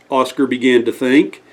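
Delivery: ordinary delivery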